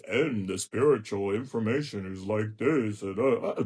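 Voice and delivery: mock solemn guru, a hint of stiffness; lightly voiced pompous teacher; stuffy serious colleague. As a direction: parody a boring spiritual lecturer. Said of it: "where they're so serious".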